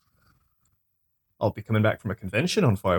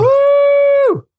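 excited cheer; 9 dB louder than usual. Real, not acted. Cheering the N64 game.

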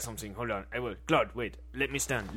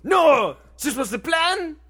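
shocked shout; 13 semitones higher, 6 dB louder, slower than usual. Acted. Betrayal revealed.

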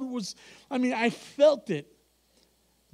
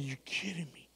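softer, whispered disbelief; 6 semitones lower, 12 dB quieter than usual. Retold or imitated. Preacher answering the Lord, whispering in disbelief. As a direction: whisper it, can't believe it. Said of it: "I said".

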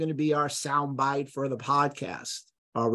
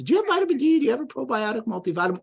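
high-pitched mock patient; put-on high voice, quoting patients; imitated patient questions; 10 semitones higher, 3 dB louder, much faster than usual. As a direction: mimic an eager supplement shopper in a raised voice. Voicing patients' usual supplement questions.

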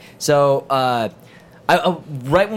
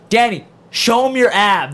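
slow, higher, louder teasing; teasing host call; 7 semitones higher, 4 dB louder, slower than usual. Retold or imitated. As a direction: egg him on slowly for the crowd. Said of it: "Nick Scarpino's like".

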